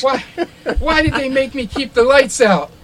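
high, loud whiny complaint; high aggrieved whine with a laugh; NPC complaining. Acted. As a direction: whine about the darkness.

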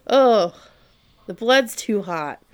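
pained, slow dying gasps; a hammed-up dying groan; dying man voice. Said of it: "and he's like".

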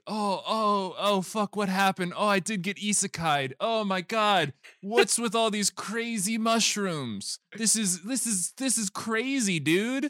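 high-pitched bewildered dude voice; mock astonished newcomer; Chris Pratt. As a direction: sound dazed and amazed, pitched well up. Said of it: "Chris Pratt is there, and he's like".

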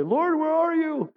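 high, slow mock wail; crying out to God. Someone on a narrow trail crying to God, mild mock wail. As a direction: plead upward like someone about to fall, lightly acted. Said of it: "you start crying and say".

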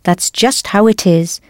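normal talking voice

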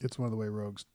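quiet aside. Real, not acted.

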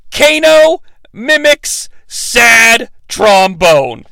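very slow, word-by-word emphasis; deliberate caption reading. Retold or imitated. Host quotes the closed captioning, slowly and emphatically. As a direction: pause after each word for comic weight.

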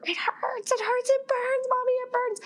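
put-on very high wailing little-boy voice; imitating crying toddler. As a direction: wail it like a hurting three-year-old. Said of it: "he starts screaming bloody murder".

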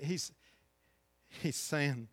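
ordinary delivery

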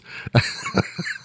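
real breathy burst of laughter; laughing. Real, not acted. Comedian tickled by the host's mistake, laughing. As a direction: crack up helplessly, mostly breath. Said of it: "I laughed for".

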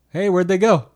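playful sarcasm, like the answer is obvious; sarcastic. Retold or imitated. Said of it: "he would say that almost sarcastically".

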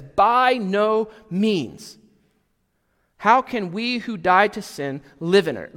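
slow, weighty By no means; emphatic denial. Retold or imitated. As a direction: stretch By no means out firmly. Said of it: "strongest negation".

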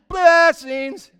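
high, loud, drawn-out blurt; involuntary mock shout. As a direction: blurt it out long and loud, against your will. Curse comes out as blessings.

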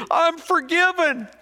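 very high, loud, slow, tearful-sounding cry; dying man's joy. Retold or imitated. Preacher voicing the dying man, high and slow. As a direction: cry it out like a freed old man. Old man repeats it in tears.

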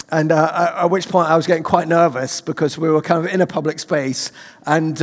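ordinary talk

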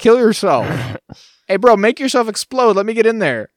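high, loud pushy bro voice; frat bro. Retold or imitated. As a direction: hype him up like a frat guy begging. Droid voiced pleading with another droid.

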